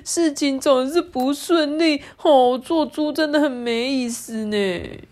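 high, drawn-out piggy whine; acted wail of a sulky little pig, high and dragged out; loud wailing complaint. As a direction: cry out at how unfair it is. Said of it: "大声叫了起来".

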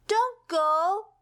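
high, pleading cry of "don't go"; begging. Acted. Pip begs the vanishing rainbow to stay, high and pleading. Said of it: "begged Pip".

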